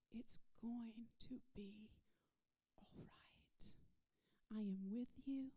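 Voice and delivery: soft, whispered reassurance; hushed whisper. Acted. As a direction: lean in and breathe comfort to someone hurting. Whispered comfort to the listener.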